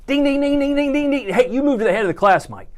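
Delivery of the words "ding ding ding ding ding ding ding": higher, sing-song ding ding ding; playful jackpot bell sound; mock bell ding. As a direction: chime it like a prize bell. Mock bell praising a viewer.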